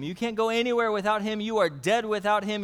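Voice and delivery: normal talking voice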